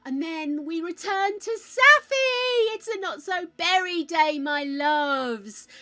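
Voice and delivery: high, loud, slow sing-song voice; singsong greeting. Pretend. Streamer greets viewers in a high sing-song coo. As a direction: coo it like a cheery hostess, drawn out. Singsong return to Sims character.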